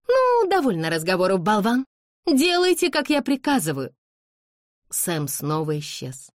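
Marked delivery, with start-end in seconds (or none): 0.1-3.9 high, loud, haughty command; imperious scolding. A narrator's reading. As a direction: snub the servant and demand obedience. Haughty lady scolds the servant. (властный раздражённый приказ)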